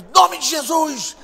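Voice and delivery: loud, high, slow command; exorcism shout. Retold or imitated. Church members praying over possessed woman, shouted rebuke. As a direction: cry it out as a rebuke. Congregation casting out a demon.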